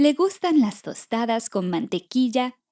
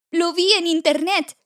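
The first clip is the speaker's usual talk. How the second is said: high, insistent chick voice; high storybook chick; frightened little chick; 6 semitones higher than usual. Acted. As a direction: answer in an excited, pitched-up little voice. Frightened chick answers the hen.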